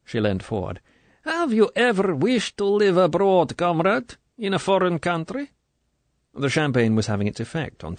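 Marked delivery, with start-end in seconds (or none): 1.2-5.5 high, wheedling question; probing colonel. A narrator's reading. Colonel sounding out the recruit, wheedling. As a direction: tempt her with the idea.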